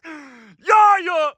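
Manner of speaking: loud, high holler; screaming for echo. Real, not acted. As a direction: shout it out for the echo. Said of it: "whatever the fuck I was screaming".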